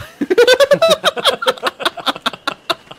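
loud high-pitched laughter. Real, not acted. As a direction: burst out laughing. Laughs at joke.

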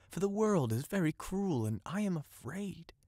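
a touch of fear, pitched high; fearful plea. A narrator's reading. Soul begs, says it is afraid.

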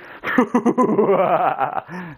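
mock evil vampire laugh; Dracula laugh. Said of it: "that's my Dracula laugh".

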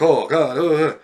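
loud startled gasp of horror at a gruesome picture; shocked reaction. Retold or imitated. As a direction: react as if seeing something awful. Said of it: "And we react".

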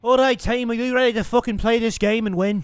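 cocky mock Irish accent, lightly done; a gamer playing brash Irish fighter Conor McGregor; swaggering put-on Irish brogue. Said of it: "My name is fucking Conor McGregor".

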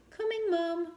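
high, loud dutiful call; called back obediently. Acted. Girl calls back to her mother on the sand, high and dutiful. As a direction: call back sweetly to mum. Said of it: "Kyla called back obediently".

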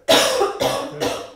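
real choking cough; coughing up water. Real, not acted. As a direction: cough like water went down the wrong pipe. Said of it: "Sorry, I have to cough".

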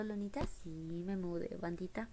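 usual speaking voice

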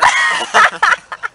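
high loud shrieking laughter. Real, not acted. Student laughs at friend's request, shrieking laugh.

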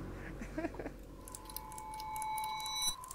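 high breathless giggle. Real, not acted. Player giggles at her own toast, a high breathless giggle. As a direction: giggle at yourself.

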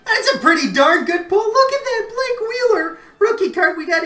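real overexcited squeal over a rookie card; excited shout. Real, not acted. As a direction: lose it over a big pull. Excited big card pull.

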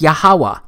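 "Yahweh" said as ya-HA-wah, with ah vowels; pronounced "ya-HA-wah". Shown as a demonstration. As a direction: say "Yahweh" in three syllables, ya-ha-wah. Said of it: "it's pronounced as Yahweh".

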